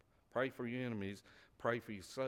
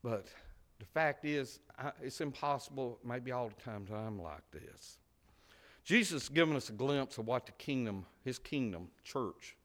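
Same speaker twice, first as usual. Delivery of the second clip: normal talking voice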